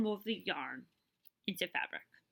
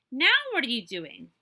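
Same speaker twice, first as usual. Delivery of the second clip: loud curious neighbor; 2 semitones higher, 9 dB louder than usual. A narrator's reading. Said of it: "another neighbor asked".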